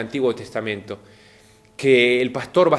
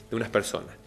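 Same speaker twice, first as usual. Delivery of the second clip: ordinary talk, nothing marked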